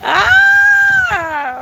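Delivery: high shrieking ah; delighted shriek. Real, not acted. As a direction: let out a shocked shriek. Shocked laughing shriek.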